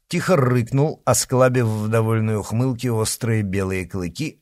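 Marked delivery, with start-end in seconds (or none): none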